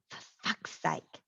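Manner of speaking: slow, whispered swearing under breath; muttered curse. A narrator's reading. Marisol muttering at Roberts' plan, whispered. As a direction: curse quietly in disbelief.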